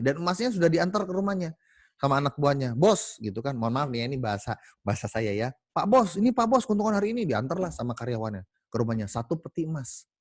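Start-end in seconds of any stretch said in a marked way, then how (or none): 2.7-3.2 worker calling boss. Acted. 5.7-7.2 higher, louder eager servant; a worker bringing gold to his boss, pitched up. Acted. Worker hands over profit.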